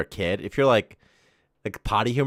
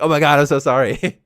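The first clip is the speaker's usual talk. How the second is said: loud, high, sorry exclamation; mock apologetic; 6 semitones higher, 11 dB louder than usual. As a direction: apologize in sudden horror, mock style. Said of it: "you're like".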